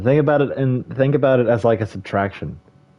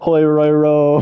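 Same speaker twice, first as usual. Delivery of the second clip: loud, high, slow name call; mock announcer; 6 semitones higher, 6 dB louder, slower than usual. Streamer mock-announcing a misspelled name, loud and slow. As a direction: boom out the name like a ring announcer.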